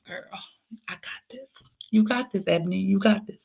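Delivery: near-whispered pep talk to yourself; soft, half-whispered self-reassurance; hushed self-talk. Retold or imitated. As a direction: quietly talk yourself round. Quiet pep talk to herself.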